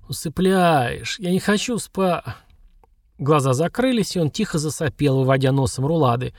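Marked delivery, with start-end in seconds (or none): 0.1-2.4 сонно, медленно, обрываясь; засыпай на полуслове; засыпая. Acted. Засыпает под чарами. (засыпая, еле ворочая языком)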